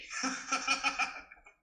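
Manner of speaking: high, pealing mock laughter. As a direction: cackle at your own cheap joke, imitating. Laughs at officer's homophobic pun.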